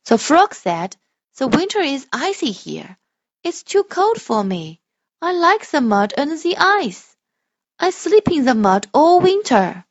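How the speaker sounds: loud, slow storybook voice; reading to children. Shown as a demonstration. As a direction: read it slowly and grandly for a child. Parent models expressive reading.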